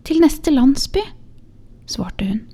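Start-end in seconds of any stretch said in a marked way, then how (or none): 0.1-1.0 put-on young mother's voice. Young mother answers the shoemaker.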